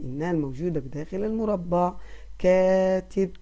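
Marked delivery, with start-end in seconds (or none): 2.4-3.3 كاتب loud with the aa stretched out; emphatic long vowel. Shown as a demonstration. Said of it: "صوتها طويل".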